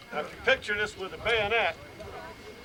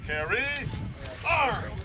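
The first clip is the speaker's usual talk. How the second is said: drill command; 7 semitones higher, slower than usual. Shown as a demonstration. Drill instructor calling command.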